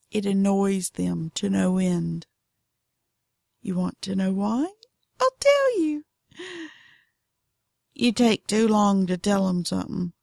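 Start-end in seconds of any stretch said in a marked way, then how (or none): 5.2-6.0 very high, put-on little voice; mock-sweet squeak well above normal talk; playful high voice. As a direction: pipe it up high, teasingly. Teasing answer to own question.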